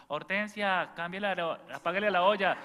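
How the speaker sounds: high, loud mock yell at Hortensia; yelling grandmother. Grandmother breaks off her rosary to shout at Hortensia about the pot, loud high mock yell. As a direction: bark orders across the house between prayers. Grandmother yells mid-prayer.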